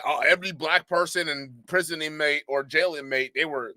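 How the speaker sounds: normal talking voice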